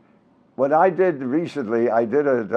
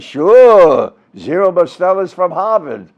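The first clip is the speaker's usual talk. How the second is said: loud sarcastic outburst, drawn-out first word; mad retort; 3 semitones higher, 8 dB louder than usual. Retold or imitated. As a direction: retell it as an indignant, booming comeback. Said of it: "He got mad".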